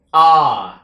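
loud, drawn-out "A"; long A sound. Shown as a demonstration. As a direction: hold "A" long and push it out loud. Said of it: "nó dùng âm A dài các bạn". (âm "A" dài, nói to)

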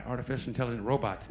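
ordinary delivery

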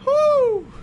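high startled whoo; startled whoop. Real, not acted. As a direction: yelp out in fright. Reacting to scary kid.

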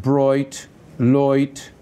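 Dublin vowels, "broit, loit" with oi for I; Dublin accent. Shown as a demonstration. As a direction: turn the I into oi like a Dubliner. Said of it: "if I want to do a Dublin accent, I have to turn that I into oi".

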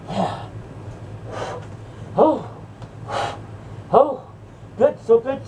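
low, blissful smoker's moans; savoring moans. Pretend. Smoker enjoying hits of pot, low savoring moans.

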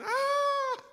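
put-on very high, loud shriek of a startled baby; toddler scream. Baby girl screams after tumbling down the stairs, piercing high shriek. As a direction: let out a piercing toddler wail. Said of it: "she just starts screaming".

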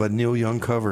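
ordinary talk

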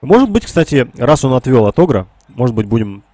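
ordinary delivery

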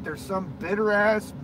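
ordinary talk

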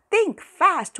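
high, loud call across the yard. Acted. Girl yelling at Walter during dodgeball. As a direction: shout a warning to a playmate.

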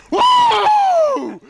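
very high, loud "Woo!"; whooping cheer. Real, not acted. Hyping up the buddy's rig with a high, loud whoop. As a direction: let out a wild whoop.

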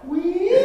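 high imitated alarm beep; mock beeping timer. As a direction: squeal like the iPod timer. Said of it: "my iPod went".

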